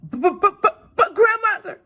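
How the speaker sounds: put-on a scared girl stuttering; frightened stammer.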